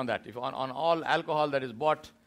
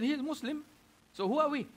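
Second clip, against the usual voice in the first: much higher, pressing the question home; pointed rhetorical question; 10 semitones higher than usual. Retold or imitated. Preacher repeats the brother's challenge to the congregation, much higher and pointed. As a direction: repeat it to make the listeners think.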